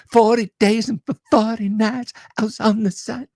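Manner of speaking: high-pitched pop-star voice. Acted. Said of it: "the [name] glove".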